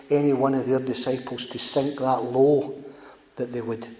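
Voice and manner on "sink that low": drawn out slowly, each word weighed; slowed emphasis. Real, not acted. Preacher dwelling on the humiliation.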